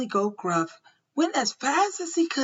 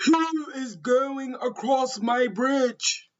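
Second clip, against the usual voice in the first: slow, mildly raised storybook shout; troll's shout; 4 semitones higher, slower than usual. A narrator's reading. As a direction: draw out the troll's challenge. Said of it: "the troll jumped from under the bridge and shouted".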